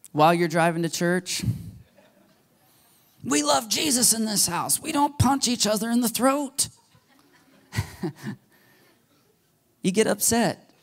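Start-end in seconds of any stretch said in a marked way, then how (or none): 3.2-6.7 high, exasperated parent scolding the back seat; mock-stern parent pleading high. Parent scolding kids in car.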